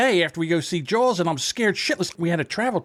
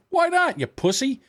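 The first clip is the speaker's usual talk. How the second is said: taunting dad; 9 semitones higher than usual. Retold or imitated. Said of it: "dad taunts son".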